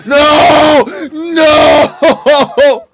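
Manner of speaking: loud high panicked "No!"; real shout. Real, not acted. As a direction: yell it as the dog lunges. Dog attacks, he yells.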